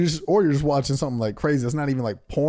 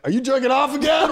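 high, incredulous mock shout; talking animal; 11 semitones higher, 4 dB louder than usual. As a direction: exclaim it like an exasperated pet. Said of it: "that animals were like".

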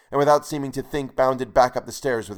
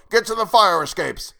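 loud, high urgent command; yelled warning; 9 semitones higher, 5 dB louder than usual. A narrator's reading. Grandfather warning the tenants from below, yelled. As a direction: shout it up the stairwell to the neighbours. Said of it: "who yelled up at them".

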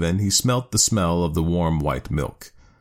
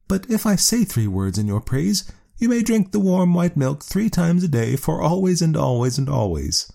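much higher, bright proud wife; high woman's voice, pleased; 11 semitones higher than usual. A narrator's reading. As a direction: raise the pitch high as the woman. Woman character voice.

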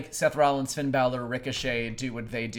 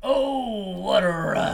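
wrestling promo; 7 semitones higher, 4 dB louder, much slower than usual. Retold or imitated. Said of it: "sounds like they're sucking, like, the miasma".

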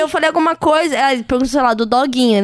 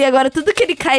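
usual speaking voice

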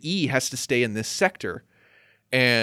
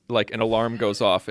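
normal talking voice

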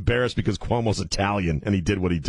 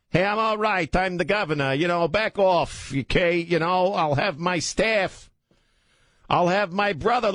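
pleading governor; 9 semitones higher than usual. Acted. Acted Cuomo pleading.

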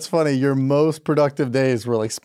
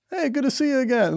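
lightly raised, cheery dog greeting; a dog greeting another dog, a bit higher; friendly dog voice; 10 semitones higher than usual. Pretend. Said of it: "what do you think they're saying".

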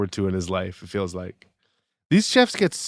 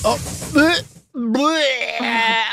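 sputtering and gagging; choking on a drink; coughing up water; 11 semitones higher, much slower than usual. Real, not acted. It went down the wrong pipe.

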